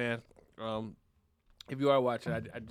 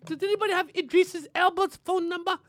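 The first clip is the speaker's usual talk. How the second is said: high mock-British royal falsetto; Queen Elizabeth; 9 semitones higher than usual. The queen seeking a phone number, high hammed-up British voice. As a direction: ask in a put-on regal voice. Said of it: "She's like".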